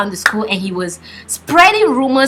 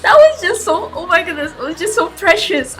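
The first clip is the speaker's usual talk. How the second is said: gushing; 9 semitones higher, 4 dB louder than usual. Retold or imitated. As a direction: melt over how precious it was. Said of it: "I just thought".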